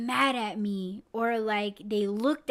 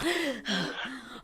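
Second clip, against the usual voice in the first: sharp mock gasp of fright; startled waking gasp. Podcaster acts out jumping awake from a falling dream, startled gasp. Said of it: "it wakes us up".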